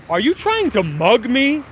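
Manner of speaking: loud retold confrontation, voice lifted high; retold loud challenge. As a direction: call it out for bystanders to hear, pitch jumping up. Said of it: "I said it really loud".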